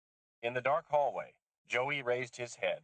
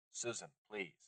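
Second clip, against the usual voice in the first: much softer, gentle plea; 9 dB quieter than usual. Acted. Lisha begging Susan to stop, soft plea. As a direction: hush her gently.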